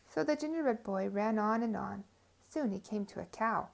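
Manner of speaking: ordinary delivery, nothing marked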